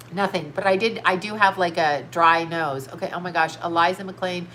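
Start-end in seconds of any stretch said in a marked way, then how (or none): 0.0-0.5 laughing whisper. Real, not acted. Laughing denial.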